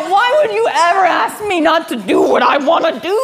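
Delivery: high, loud laughing protest; mock whiny complaint. Believer to God, mock whiny shout. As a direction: complain like a spoiled child.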